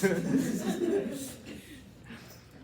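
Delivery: bursts into a high real laugh. Real, not acted. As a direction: laugh freely at the joke. Laughing at the audio joke.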